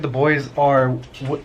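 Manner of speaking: normal talking voice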